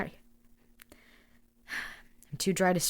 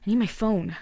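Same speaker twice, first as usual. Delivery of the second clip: ordinary delivery, nothing marked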